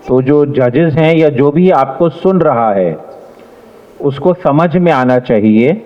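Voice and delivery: ordinary talk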